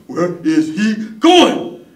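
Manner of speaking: loud, slow, high-pitched disbelief; startled double take. Acted. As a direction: blurt it out in astonishment, word by word. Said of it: "he did a double take".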